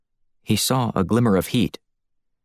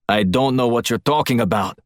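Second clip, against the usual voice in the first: Russian guard; 3 semitones higher than usual. Acted. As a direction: play the pinned guard denying it, barely changed from the narrator. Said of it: "the Russian guard again".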